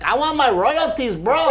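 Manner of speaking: loud, high-pitched demand in jest; cocky jokester. Retold or imitated. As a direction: act out a brash bro, voice pushed way up. Acting out Pollito joking at show.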